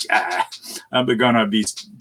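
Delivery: ordinary talk, nothing marked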